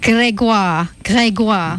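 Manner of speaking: "Grégoire" in native French, "gwar" ending; "Grégoire" with French vowels, falling; French pronunciation. Shown as a demonstration. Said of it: "know their name in French via you".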